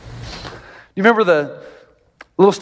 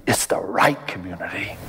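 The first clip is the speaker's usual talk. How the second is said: whispered aside; 11 semitones lower, 6 dB quieter than usual. Real, not acted. Pastor drives home his point.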